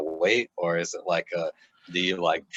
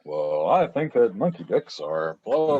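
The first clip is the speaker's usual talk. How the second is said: slow, measured anchor delivery; newscaster voice; 3 semitones higher, 3 dB louder, slower than usual. Pretend. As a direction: answer like a serious news anchor. Mock newscaster answers the handoff.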